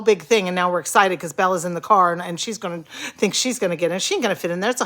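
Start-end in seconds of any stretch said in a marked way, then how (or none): none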